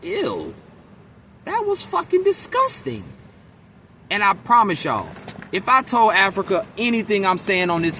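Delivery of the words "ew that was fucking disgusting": disgusted. Retold or imitated. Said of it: "I was like".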